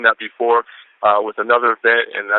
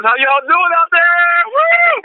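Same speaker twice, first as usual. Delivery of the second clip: excited hype shout; 4 dB louder, slower than usual. Real, not acted. Hyping up partners on the call.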